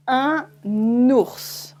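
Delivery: "Un ours" with the N carried into "ours"; liaison un ours. Shown as a demonstration. Teacher models "Un ours" for learners, N linked. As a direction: link "Un" to "ours" with an N sound. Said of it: "you can hear the difference".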